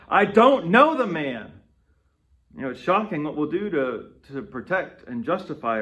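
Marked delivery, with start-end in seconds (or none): 0.1-1.5 loud, higher, slow angry outburst; angry denial. Retold or imitated. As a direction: snap it out, defensive and furious. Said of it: "we get so defensive that we get angry".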